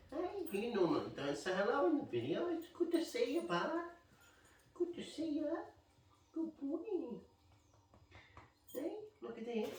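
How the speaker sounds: high, cooing voice for the dog; high pet talk greeting Roy the dog. Real, not acted. Talking to his dog Roy.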